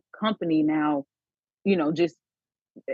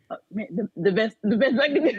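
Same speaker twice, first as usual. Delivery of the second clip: laughing attempt at the voice; 5 semitones higher, faster than usual. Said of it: "I can't even do the voice".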